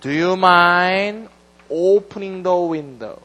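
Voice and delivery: very slow, each word drawn out; slow model sentence. Shown as a demonstration. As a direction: read it slowly for students. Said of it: "이렇게 쓰는 게 맞습니다". (천천히 또박또박 예문 읽기)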